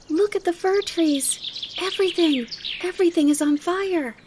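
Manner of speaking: put-on high-pitched, loud child crying out in wonder; high girlish voice bursting with excitement; excited little girl. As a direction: exclaim like an amazed child.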